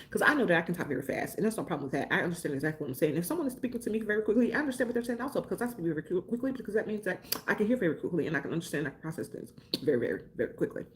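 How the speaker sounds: rapid-fire and run-together; showing off a fast talking speed. Shown as a demonstration. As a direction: race through the words to prove it. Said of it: "I will slow it down for you".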